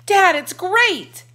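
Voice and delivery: high, loud and slow, overjoyed; thrilled boy. A narrator's reading. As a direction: gush it out like a kid amazed.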